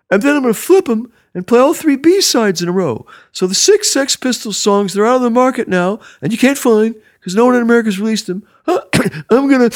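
high, loud asthmatic radio DJ; Rodney Bingenheimer's asthmatic voice. Retold or imitated. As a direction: announce the records like a wheezing late-night DJ. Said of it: "in that asthmatic voice of his".

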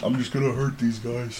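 lightly voiced female orc; a hint of a brutish orc. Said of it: "That's a terrible female orc voice".

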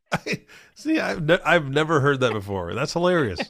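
high, loud laughing words. Real, not acted. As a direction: talk while still cracking up. Cracking up at the joke quote.

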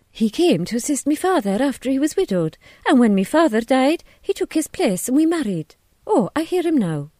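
minister's wife chatting to a visitor. A narrator's reading. Missus Hughes tells of her marriage.

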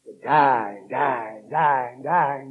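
ordinary talk

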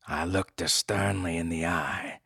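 ordinary delivery, nothing marked

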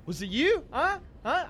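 mock-tough interrogation; intimidating. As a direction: confront them with short rising questions. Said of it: "I feel like I'm being very intimidating".

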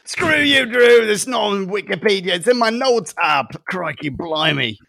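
exaggerated Australian outrage; Australian accent. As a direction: protest in hammed-up Australian, easing off at the end. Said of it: "needs her Australian accent".

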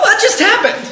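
usual speaking voice

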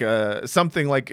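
normal talking voice

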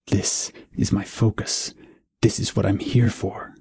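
steel yourself, inwardly; resolute inner voice. A narrator's reading. Eidolon thinks, resolved.

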